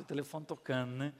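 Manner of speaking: ordinary talk, nothing marked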